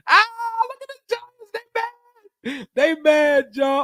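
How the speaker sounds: high mocking taunt; mocking gloat. Real, not acted. Mocking rival fans.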